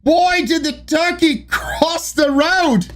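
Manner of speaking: high, slow attempt at Scottish; Scottish accent. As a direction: put on a hammed-up Scottish accent. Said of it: "Read the joke in a Scottish accent".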